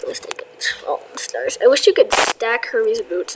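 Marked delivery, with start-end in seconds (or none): 0.1-1.6 soft unvoiced whisper. Real, not acted. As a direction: whisper it to yourself. Whispering to self.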